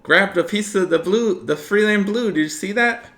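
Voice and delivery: high, loud frantic chatter; excited shout. Acted. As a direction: yell it out in a rush. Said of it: "he says".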